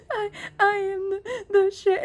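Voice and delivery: small teary voice, imitating a sobbing child; crying child. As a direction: whimper it out like a kid in tears. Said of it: "he's, like, crying".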